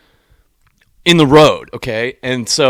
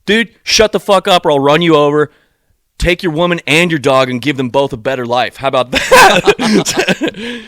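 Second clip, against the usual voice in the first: louder, higher imagined tirade, slowing at the end; wished comeback to the scooter guy, louder and higher; 5 semitones higher, 6 dB louder than usual. Pretend. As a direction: tell him off, then drag out the last question. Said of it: "what I wanted to say".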